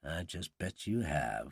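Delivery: low, soft, slow aside; hushed, knowing inner voice; private thought. A narrator's reading. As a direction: think it to yourself, sceptically. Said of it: "Rupert Dauncey thought".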